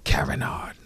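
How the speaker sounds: soft whispered name; whispered echo. Real, not acted. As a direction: breathe the name quietly. Name repeated softly.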